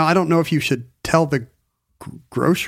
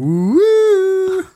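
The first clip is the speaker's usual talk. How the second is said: very high, loud falsetto woo; theremin-like falsetto whoop; 4 dB louder than usual. Retold or imitated. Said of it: "a guy going".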